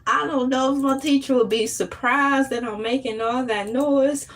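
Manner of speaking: ordinary talk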